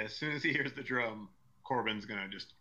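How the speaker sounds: ordinary talk, nothing marked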